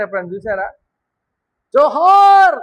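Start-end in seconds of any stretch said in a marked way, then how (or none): 1.7-2.6 high, loud salute cry; rally shout. Retold or imitated. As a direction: bellow it like a crowd hailing a leader. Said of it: "ఎంత గట్టిగా అరుస్తారు తెలుసా?". (గట్టిగా జోహార్ అరుపు)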